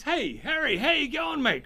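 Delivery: put-on high, bright hail to an old mate; cheery greeting. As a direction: call out high to your old friend. [name] greets old friend in joke.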